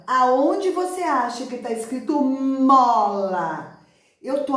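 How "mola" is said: MOLA with a long held M and stretched vowel; slow, emphatic MOLA; drawn-out sounding out. Shown as a demonstration. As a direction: stretch MOLA slowly so each sound can be heard. Stretches word for child to find.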